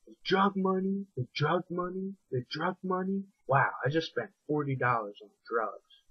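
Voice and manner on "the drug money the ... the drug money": higher, getting softer each repeat; a phrase echoing and fading in his head; echoing in the mind. Retold or imitated. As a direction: let it echo away into silence. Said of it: "echoed in my mind".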